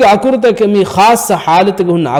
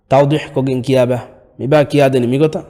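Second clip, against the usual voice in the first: normal talking voice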